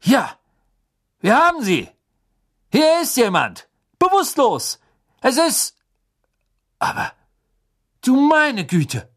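high, loud shout from the trees; calling out. Acted. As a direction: yell back the discovery, then shock. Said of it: "hörten sie Mister Diggory rufen".

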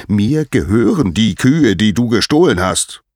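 indignant, booming confrontation; angry claim. Acted. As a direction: assert ownership forcefully at a thief. Apollo confronts the thief.

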